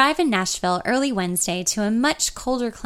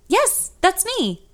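amazed; 11 semitones higher, much slower than usual. A narrator's reading. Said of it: "I say, amazed".

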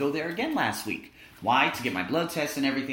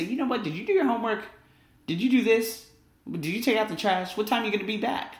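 high, pressing string of parent questions; suspicious parent; 9 semitones higher than usual. Retold or imitated. Parent interrogating the other child, high and pressing. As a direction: grill the kid one question after another.

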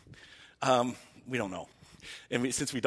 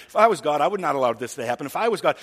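louder, building protest; indignant outburst; 9 dB louder, faster than usual. Retold or imitated. Someone protesting what God allowed, loud and indignant. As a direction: hammer each if I was God harder. Mimicking people blaming God.

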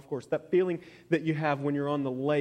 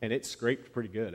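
ordinary talk

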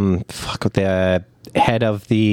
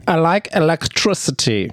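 high-pitched mock Southern accent; hammed-up accent; 9 semitones higher than usual. Co-host imitating the executioner, hammed-up Southern accent. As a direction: put on a high, exaggerated Southern twang. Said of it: "He's got an accent for sure".

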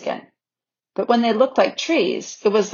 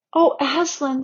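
put-on higher, dismayed child's voice; dismayed pleading; 7 semitones higher than usual. A narrator's reading. Lucy under the lion's straight look, pleading. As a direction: appeal to him in a raised pitch.

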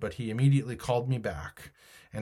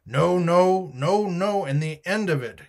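loud, higher angry cry; 6 semitones higher, 5 dB louder than usual. A narrator's reading. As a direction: shout it in a rage. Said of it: "he cried once".

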